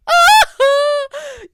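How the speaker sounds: high, loud dramatic cry; hammed-up emotional outburst; loud mock outburst. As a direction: burst out with an exaggerated high wail. Re-enacts an emotional film scene.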